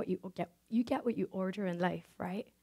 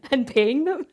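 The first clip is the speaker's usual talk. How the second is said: laughing; 7 semitones higher, 11 dB louder, slower than usual. Real, not acted. Laughing at the absurd idea.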